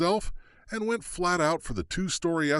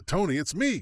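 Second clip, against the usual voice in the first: higher call full of relief; relieved yell; 7 semitones higher than usual. A narrator's reading. Kate calls to the marshal, relieved yell. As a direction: call out to him, glad he's here. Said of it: "Kate yelled with relief".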